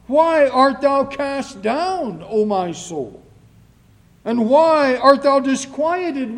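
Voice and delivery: loud, high, fervent scripture reading; declaimed psalm with big swells on key words; psalm proclamation. Acted. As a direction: cry the psalm out from the pulpit.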